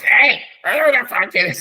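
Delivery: half-managed frog impression. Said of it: "with that frog voice".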